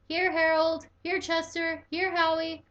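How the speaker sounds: high, loud calling like to pets; chiming call. A narrator's reading. Pete calls the pets, high and loud. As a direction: call each one by name. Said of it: "Pete chimed in".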